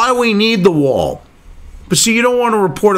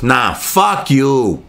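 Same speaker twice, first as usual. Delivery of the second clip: slow, drawn-out, lower mock Trump comeback; mock Trump retort; 11 semitones lower, much slower than usual. Trump answering China, slow smug mock retort. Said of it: "He goes".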